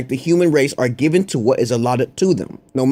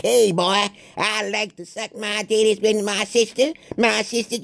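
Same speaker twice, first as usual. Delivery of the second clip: mocking southern cracker; 6 semitones higher than usual. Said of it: "them crackers is like".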